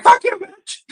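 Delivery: shrill-pitched mocking insult; mocking Shani. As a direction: squeal it high like the woman you mock. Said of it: "she was like".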